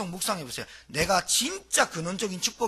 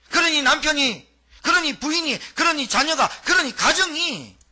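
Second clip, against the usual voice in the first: higher, louder, slowing list; preaching build; 8 semitones higher, 6 dB louder than usual. Acted. Preacher listing family troubles to congregation, building. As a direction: hammer each item home.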